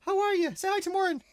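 high-pitched parent talk. Real, not acted. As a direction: lift the voice right up for a little one. Coaxing small child to greet.

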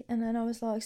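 ordinary talk

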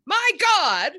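high, loud astonished cry; amazed exclamation. Retold or imitated. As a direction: gasp it out like you just heard a miracle. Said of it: "he picked up the other end and went".

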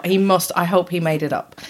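ordinary delivery

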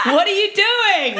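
high, loud, laughing alarm; loud laughing outcry. Retold or imitated. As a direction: shriek it through laughter. Wife confronts husband, retold.

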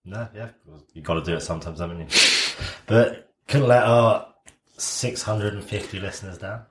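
normal talking voice

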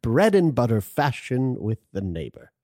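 a hint of an English accent; light English accent. Shown as a demonstration. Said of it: "English accent bit continues".

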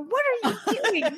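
high incredulous mom voice; startled parent yelping at her kid; shocked mom. Retold or imitated. Mom scolding son's antics.

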